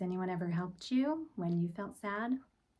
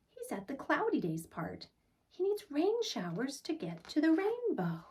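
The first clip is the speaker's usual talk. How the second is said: put-on higher little girl telling Pa; child voice; 8 semitones higher than usual. Laurel telling Pa about the doll, higher child voice. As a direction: speak it up like a worried child.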